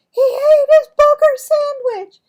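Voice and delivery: very high, loud horrified cry; disgusted shriek. Acted. Heather tells everyone Marvin ate it, very high loud horrified cry. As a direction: scream it to the whole room.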